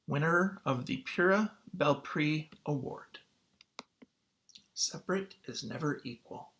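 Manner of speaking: put-on clear, expressive picture-book reading for kids; story read-aloud. Teacher reading a picture book to students, expressive read-aloud. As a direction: read the story to the class, drawing them in.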